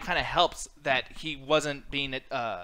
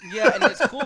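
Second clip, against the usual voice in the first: laughing; 10 semitones higher, 9 dB louder than usual. Real, not acted. Laughing while starting to answer.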